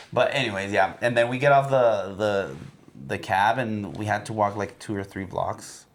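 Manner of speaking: ordinary delivery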